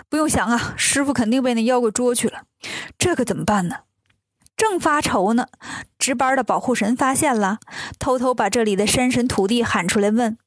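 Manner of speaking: plain reading voice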